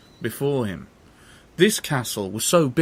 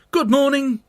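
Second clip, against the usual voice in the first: put-on pitched-up boyish call; high Jack voice; 13 semitones higher, 6 dB louder than usual. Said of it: "cried Jack".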